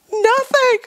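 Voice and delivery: high, loud blurted answer; shouted answer. Retold or imitated. Pregnant wife snapping back at husband, high and loud. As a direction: fire back the word Nothing.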